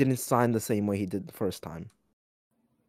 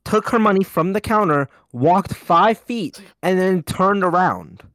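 high, loud, emphatic protest; heated self-defense; 8 semitones higher, 5 dB louder than usual. Real, not acted. Teller defending themself over being scammed, heated and loud. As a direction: insist on it, punching out each step.

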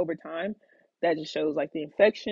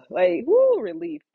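high, loud burst of relief; sudden relieved cheer, much higher and louder; relieved whoop; 11 semitones higher, 7 dB louder, slower than usual. Retold or imitated. Fluid finally comes out.

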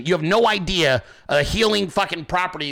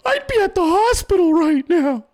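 high-pitched mock alarmed voice; Randy; 12 semitones higher, 5 dB louder than usual. Wife telling him to get to hospital, high mocking imitation. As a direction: imitate a worrier loudly in a much higher pitch. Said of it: "Randy was like".

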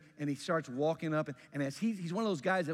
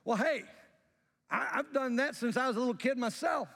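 put-on high-pitched, self-important rich young ruler; pompous; 8 semitones higher than usual. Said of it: "very pompously says".

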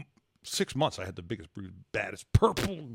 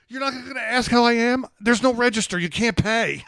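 high, loud, disbelieving retold rant; incredulous outburst; 9 semitones higher, 5 dB louder than usual. Retelling yelling at oblivious shopper, high loud disbelief. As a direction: demand why she ignores you, loud and high. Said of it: "I go".

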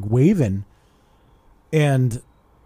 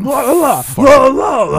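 very high, loud nonsense shout; mock him like a caveman; hammed-up taunting la-la shout; 12 semitones higher, 9 dB louder than usual. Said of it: "I'm kinda just mocking him".

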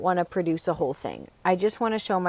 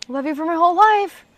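put-on child voice; 9 semitones higher, 6 dB louder than usual. Voicing daughter at bedtime.

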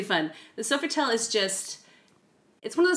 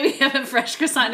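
mock French accent, higher and louder; 6 semitones higher, 5 dB louder than usual. Retelling the wake-up call, in a mock French accent. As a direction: ham up a French hotel receptionist. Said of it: "It's, like, some French accent".

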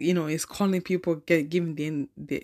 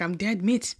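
ordinary talk

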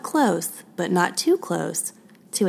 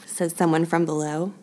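usual speaking voice

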